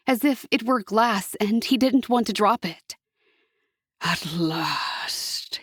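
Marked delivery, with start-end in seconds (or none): 4.0-5.6 low unvoiced devil's whisper; a low whisper of triumph. A narrator's reading. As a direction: breathe it out in triumph. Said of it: "he whispered".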